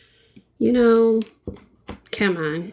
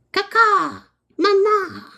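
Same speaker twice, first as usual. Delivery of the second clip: very high, squeaky kaka mama; silly squeaky voice; 14 semitones higher, slower than usual. Pretend. Painter goofing a squeaky baby voice for viewers. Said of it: "when I'm doing voices".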